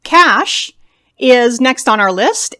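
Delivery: ordinary talk, nothing marked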